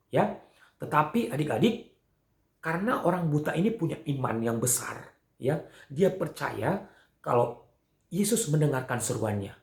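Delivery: usual speaking voice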